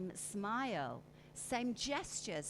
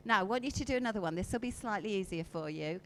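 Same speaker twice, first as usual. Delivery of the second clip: ordinary talk, nothing marked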